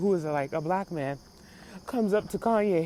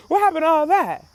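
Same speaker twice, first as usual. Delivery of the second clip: loud, high, incredulous question; exasperated outcry; 7 semitones higher, 8 dB louder than usual. Real, not acted. Calling out [name]'s old toughness, loudly exasperated. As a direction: throw the question out in loud disbelief. Challenging [name], repeated for effect.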